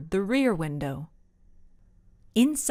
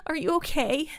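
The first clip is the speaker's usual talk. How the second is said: high, worried question; a girl's anxious, high question; voice breaking; 8 semitones higher than usual. A narrator's reading. Ava to her mom, anxious and high-pitched. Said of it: "her voice breaking".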